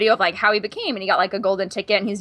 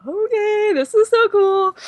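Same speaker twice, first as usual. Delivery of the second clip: high, slow, stretched-out mock squeal; giddy awe; 11 semitones higher, slower than usual. Guest watches the stars talking and pointing, giddy awe. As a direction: draw it out like an overwhelmed fan.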